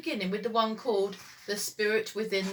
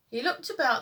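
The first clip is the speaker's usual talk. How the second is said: usual speaking voice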